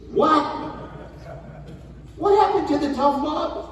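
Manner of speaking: high incredulous cry. Real, not acted. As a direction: sound baffled and let it ring out. Incredulous outburst at audience.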